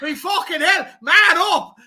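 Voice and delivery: high, loud imitated yell in an attempted accent; Robbie Keane. Retelling a player's outburst at his teammate, imitated shout. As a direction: bellow it like an exasperated player on the pitch. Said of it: "that was the accent he was trying to do".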